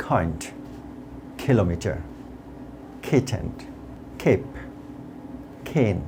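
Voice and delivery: each K puffed, from "kind" to "case"; word-list drill with airy K, as in "kitten"; aspirated K words. Shown as a demonstration. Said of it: "কিছু শব্দ উচ্চারণ করছি". ("kind", "cane"-এর ক খ-এর মতো)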